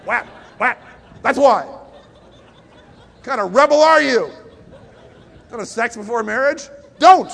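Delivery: mock angry parent. Stage two parent yelling at rebellious teen, mock fury. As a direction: play a furious parent shouting down the teen. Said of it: "they'll be saying".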